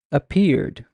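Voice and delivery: usual speaking voice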